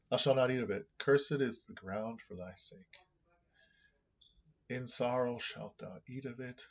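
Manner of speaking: high, loud start, slowing into long pauses; emotional scripture reading. Acted. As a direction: read the verse with weight, dropping away at the end. Reads scripture aloud.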